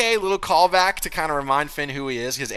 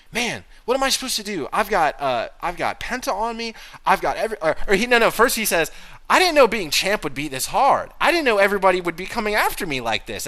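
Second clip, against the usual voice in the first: higher, complaining voice; mock whiny Dom; 8 semitones higher, 4 dB louder than usual. Voicing Dom's complaint to the co-host, a higher whine. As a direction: whine like an overwhelmed champ.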